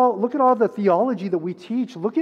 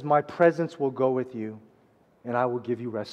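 soft, low reading of God's words; quiet promise; 7 semitones lower, 6 dB quieter than usual. Acted. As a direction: lay the promise down gently.

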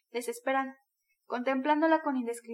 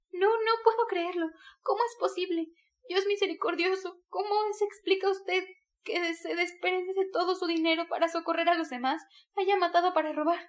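put-on higher young woman's voice, stunned and pleading; Sonia, bewildered; 6 semitones higher than usual. A narrator's reading. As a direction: Sonia reeling from the confession. Sonia's disbelieving plea. (Sonia incrédula, con voz más aguda)

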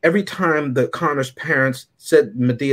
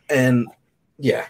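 ordinary talk, nothing marked

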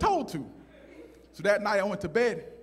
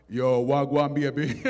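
lower-pitched smooth Jamaican greeting; showy low Jamaican accent; put-on Jamaican patois; 5 semitones lower, 4 dB louder, slower than usual. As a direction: try to impress her with Jamaican slang. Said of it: "I wanna impress her".

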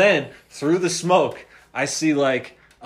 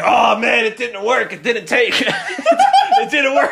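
louder, higher, collapsing into slow laughter; laughing quote; 6 semitones higher, 6 dB louder, slower than usual. Retold or imitated. Quoting the friend whose experiment failed, laughing loudly. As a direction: quote him while losing it.

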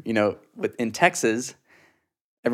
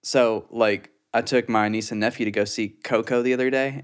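ordinary delivery

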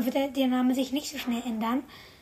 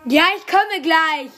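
calling to another room; 4 semitones higher, 10 dB louder, slower than usual. Real, not acted. Answers someone in another room.

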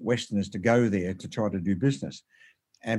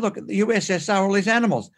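higher-pitched, eager amazement; retold excitement; 7 semitones higher than usual. Showing his partner animals on television, retold excitement. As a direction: re-enact spotting something amazing on screen.

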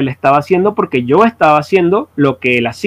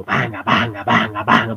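low, soft imitated band hits; mock drum hits; 7 semitones lower, 7 dB quieter than usual. Musician imitating the band's heavy beat, low mock hits. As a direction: thump out the band sound under the breath.

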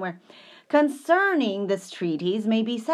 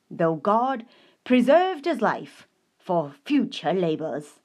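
fervent reading; 6 dB louder than usual. Acted. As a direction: preach it with conviction. Quoted passage read with fervor.

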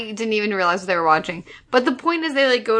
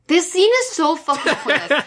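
laughing in disbelief; 6 semitones higher than usual. Real, not acted. Exasperated about pointless scene.

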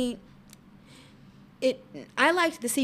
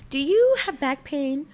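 infomercial; 3 semitones higher, 8 dB louder than usual. Acted. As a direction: read it like a cheesy TV ad. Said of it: "We'll do a commercial".

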